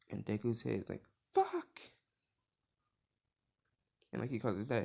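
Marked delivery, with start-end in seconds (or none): 1.3-1.8 high-pitched exclaimed curse; sudden high yelp of frustration; frustrated outburst. Retold or imitated. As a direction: blurt the swear out high. Phone call fails, exclaims.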